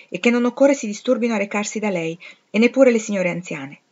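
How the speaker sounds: usual speaking voice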